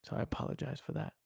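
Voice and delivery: whispered quick apology, sheepish; quick whispered aside. Real, not acted. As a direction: mutter the sorry under your breath, fast. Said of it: "I don't like cursing".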